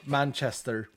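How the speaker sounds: ordinary talk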